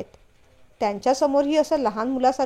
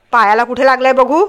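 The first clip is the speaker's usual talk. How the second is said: louder concern; 9 dB louder than usual. A narrator's reading. He asks again, louder.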